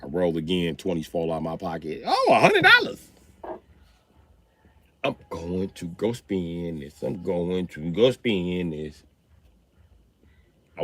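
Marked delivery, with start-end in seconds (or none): 2.1-3.1 put-on excited kid. Kid finds cash. 5.0-9.0 put-on slow, soft, scheming kid voice; sly quiet kid. Daughter pocketing dad's money, slow and sly.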